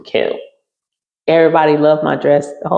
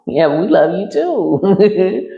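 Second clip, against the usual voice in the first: slow, higher mock-sweet reply; weary reply; 4 semitones higher, much slower than usual. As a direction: drag it out, half-hearted. Retold weary reply to friends.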